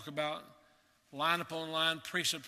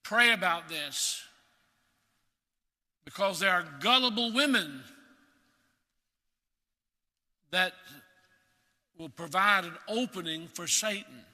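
urgent warning; 5 dB louder than usual. Retold or imitated. Preacher voicing Paul's warning to Timothy, urgent and loud. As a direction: warn the flock with rising force. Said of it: "he says".

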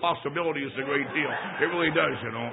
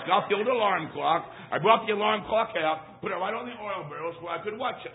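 ordinary delivery

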